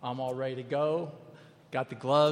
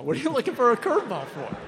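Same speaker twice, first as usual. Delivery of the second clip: mock cursing pitcher; 9 semitones higher, 8 dB louder, faster than usual.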